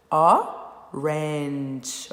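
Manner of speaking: orange broken slowly into o... range, loud first beat; "orange" slow and split in two, silent final e; two-syllable chin test. Shown as a demonstration. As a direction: say orange as two separated jaw-drops. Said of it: "Vamos ver o teste do queixo?".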